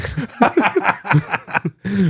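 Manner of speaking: long high-pitched burst of laughing; high laughter. Real, not acted. Co-host teased as an old dog, high laughter. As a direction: laugh helplessly at being teased.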